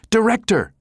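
a high, loud outburst calling the director; exploding. A narrator's reading. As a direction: blurt out the title sharply. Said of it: "Jabba exploded".

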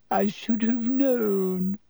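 slow, high, tearful lament; tragic. A narrator's reading. Lavender grieving her dead rabbit to the class, tragic moan. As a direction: moan it like all is lost. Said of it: "said Lavender tragically".

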